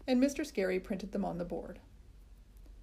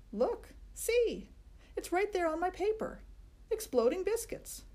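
put-on much higher, thrilled boy voice; excited boy showing off; 10 semitones higher than usual. A narrator's reading. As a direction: show the proof with glee. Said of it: "Herbert holds up his paper".

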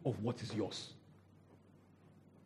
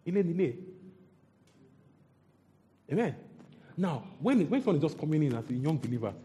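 normal talking voice

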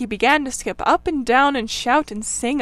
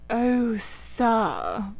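tearful washerwoman voice, lightly voiced; a poor woman pleading through mild tears; understated weepy plea; crying. A narrator's reading. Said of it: "crying afresh".

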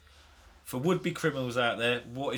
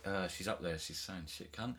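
much softer, lower; hushed tattling; 8 semitones lower, 12 dB quieter than usual. Retold or imitated. Telling sister about the niece, hushed. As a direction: lean in and murmur it. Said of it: "I was like".

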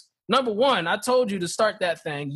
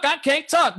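higher, laughing I can't talk; higher pitched mock complaint; exasperated mock whine; 7 semitones higher than usual. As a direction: throw up your hands in mock protest. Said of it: "you telling me to talk".

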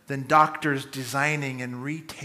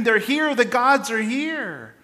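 echoed awed cry; 7 semitones higher, slower than usual. Retold or imitated. Crowd's cry echoed again.

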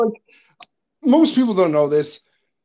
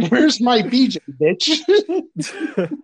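lightly mimicked silly character voice, breaking into laughter; a hint of a put-on voice, pitch jumping up; Michael; 4 semitones higher, much slower than usual. Imitating Michael goofing on set, silly put-on voice. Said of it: "he kept doing that voice".

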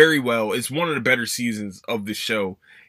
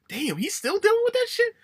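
incredulous. Retold or imitated. Mock incredulous reaction.

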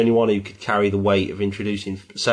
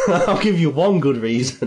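mock triumphant; 5 semitones higher, 4 dB louder than usual. Comeback to "ten good reasons.

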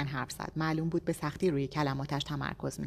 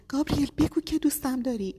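high, loud begging; a high, loud cry of pleading; desperate plea; 9 semitones higher, 5 dB louder than usual. A narrator's reading. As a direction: beg him with the last seconds left. Begging husband during countdown.

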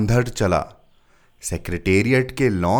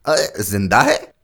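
louder, astonished clerk; surprised; 5 dB louder than usual. A narrator's reading. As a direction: react in astonishment. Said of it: "हैरत से कहा".

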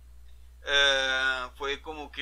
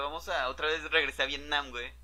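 normal talking voice

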